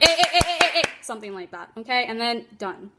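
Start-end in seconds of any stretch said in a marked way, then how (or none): 0.0-0.9 sharp, higher, louder quick "eh, eh" bursts; a clipped interrupting noise, not a scream; the right way, brief sharp startle calls; short startle sounds. Shown as a demonstration. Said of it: "What I want you to do more of is like".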